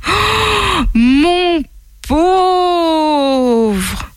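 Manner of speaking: high, drawn-out lament; pitying wail. Acted. Monkey seeing the bruised camel arrive, high pitying lament. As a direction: wail it high, stretching each word. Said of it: "plaignait-il, le malheureux chameau".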